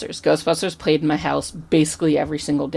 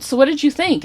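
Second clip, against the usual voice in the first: high, eager question in an imitated actress voice; eager star; 10 semitones higher than usual. Actress asking a fan after the movie, eager. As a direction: lift the pitch, keen to hear the answer.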